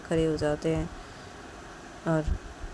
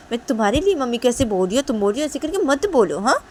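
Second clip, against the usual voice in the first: fast, higher indignant reply; indignant retort; 7 semitones higher, 8 dB louder than usual. Acted. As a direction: fire back, fast and high. Tisha retorts to Shanaya.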